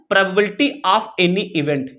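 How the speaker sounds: very slow, every word spaced; slow dictation. Shown as a demonstration. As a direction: spell it out slowly as you write. Teacher dictates while writing.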